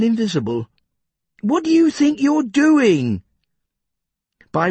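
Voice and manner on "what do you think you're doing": high, louder outraged question; indignant. A narrator's reading. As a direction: demand an answer, outraged. Jeff protests Tom's spade blow.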